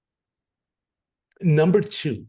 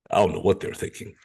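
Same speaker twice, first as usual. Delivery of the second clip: low-pitched, quick puzzled impression; put-on low voice, mildly bewildered; Stevie; 7 semitones lower, faster than usual. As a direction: imitate a baffled friend, voice dropped deep. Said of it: "that's my impression of Stevie's voice".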